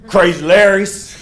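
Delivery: loud, slow gruff phone greeting of a trail hostel owner; hammed-up Crazy Larry answering the phone. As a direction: bark the shop name into the phone. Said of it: "I'm doing my best Crazy Larry voice".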